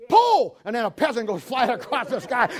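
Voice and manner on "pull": high, loud skeet call; shouted command. Retold or imitated. King ordering peasant launched, shouted skeet call. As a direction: yell pull at the range.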